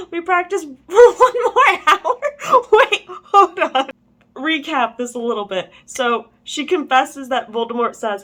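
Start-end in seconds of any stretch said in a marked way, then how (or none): 0.1-3.9 high, loud, laughing incredulously; words bursting out through laughter; real laughter. Real, not acted. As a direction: crack up while trying to read on. Cracks up while reading.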